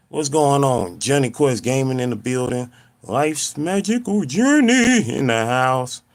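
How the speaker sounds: loud, high showman intro; big hype shout-out, slowing down at the end. Acted. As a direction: announce it like a stage host. Mock hype channel intro.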